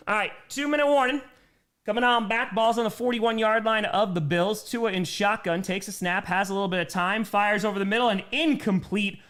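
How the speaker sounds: energetic live game call; play-by-play. Acted. Calling the Dolphins drive for chat, lively play-by-play.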